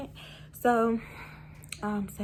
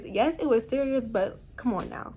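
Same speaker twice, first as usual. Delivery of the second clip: ordinary talk, nothing marked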